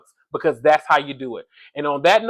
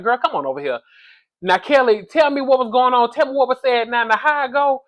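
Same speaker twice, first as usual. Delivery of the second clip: put-on higher, eager sassy girlfriend voice, lightly done; imitating Kenya; 7 semitones higher than usual. Kenya calling Kelly over for the tea, mock eager and sassy. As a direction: coax her over for the gossip, a bit higher.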